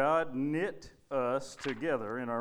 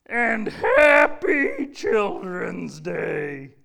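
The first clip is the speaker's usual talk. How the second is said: slow, high, loud stretched-out welcome; big puppet greeting; 11 semitones higher, 11 dB louder, much slower than usual. Acted. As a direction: boom it out to the whole church.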